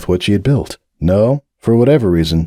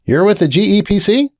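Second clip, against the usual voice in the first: higher, friendly barkeep; bartender voice; 12 semitones higher than usual. A narrator's reading. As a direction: voice the bartender up high and welcoming. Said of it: "the bartender asked".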